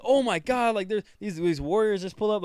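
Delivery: mock panic, pitched well up; imitated freak-out. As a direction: act out a startled woman freaking out. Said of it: "She's freaking out".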